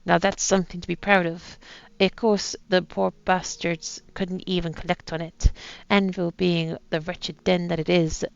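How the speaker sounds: put-on light pirate accent reading a captain's journal; lightly voiced pirate accent, a gruff old sea captain; soft reading in a light pirate accent. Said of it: "with an accent I've decided to put on".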